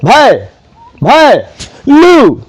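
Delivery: भय and लू as isolated syllables; one piece at a time: भय / भय / लू; sounded out. Shown as a demonstration. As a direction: pause after each भय, then a lone लू. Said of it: "उसके बाद क्या आ रहा है?".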